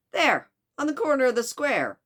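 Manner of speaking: high, loud attempt at a Chicago accent; Mister Fix. Detective directs servant to the consulate, poor Chicago accent. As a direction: point it out in a rough Chicago accent. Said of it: "my very poor attempt at a Chicago accent".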